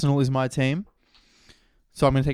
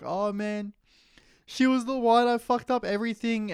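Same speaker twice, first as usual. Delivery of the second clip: heartbroken friend; 8 semitones higher than usual. Retold or imitated. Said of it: "a friend gets out of a relationship".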